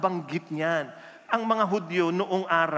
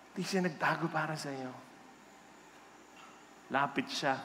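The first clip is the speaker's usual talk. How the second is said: solemn, tender; 5 semitones lower, 5 dB quieter than usual. Real, not acted. Preacher telling the congregation Jesus did not hide, hushed and sincere. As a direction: let it sink in softly, with long pauses.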